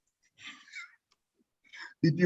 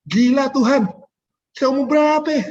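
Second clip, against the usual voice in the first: much higher and louder, amazed outcry; astonished exclamation; 11 semitones higher, 7 dB louder than usual. Retold or imitated. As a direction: blurt it out, stunned by the promise. Retelling his stunned reaction to God. (seruan kaget dan takjub, jauh lebih tinggi dan keras)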